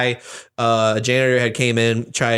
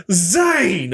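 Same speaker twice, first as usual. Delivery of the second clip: excited reply; 6 semitones higher than usual. Retold or imitated. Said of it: "whenever my dad tells the story".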